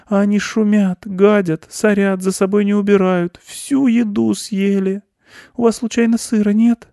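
high, loud tearful mouse grievance; plaintive mouse squeak; nearly crying. A narrator's reading. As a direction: pour out woes in a small high voice. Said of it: "Мышь уже чуть не плакал".

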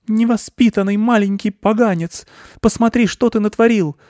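high-pitched angry aunt; indignant aunt mouse telling-off; scolding aunt. A narrator's reading. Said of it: "вторила ему тётя".

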